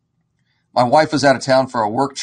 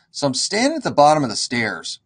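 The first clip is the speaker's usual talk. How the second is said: usual speaking voice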